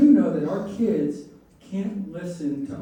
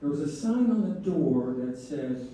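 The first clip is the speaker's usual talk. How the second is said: ordinary talk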